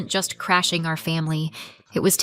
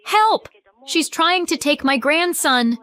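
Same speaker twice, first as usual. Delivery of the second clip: yelling for help; 9 semitones higher than usual. Acted. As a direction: scream to passers-by. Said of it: "She yelled".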